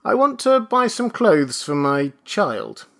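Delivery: high, uneasy request; nervous. A narrator's reading. Father asks the clerk, nervous. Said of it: "Mister Button said nervously".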